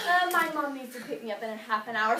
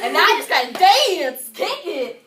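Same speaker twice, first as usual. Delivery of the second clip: big entrance cue; 3 semitones higher, 7 dB louder than usual. Acted. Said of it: "when I say kick it".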